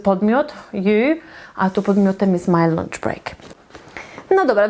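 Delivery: ordinary delivery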